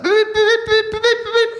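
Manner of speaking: high, loud imitated beeping; parking sensor beeps. Comedian imitating a car parking sensor, high beeps. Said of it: "it's like a parking sensor".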